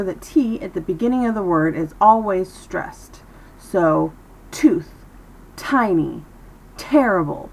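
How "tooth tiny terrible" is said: hard, aspirated T starting "tooth", "tiny", "terrible"; strong initial T. Shown as a demonstration. Teacher models stressed T at word beginnings, strong crisp T. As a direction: hit the first T of each word hard. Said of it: "the T is very loud, very strong".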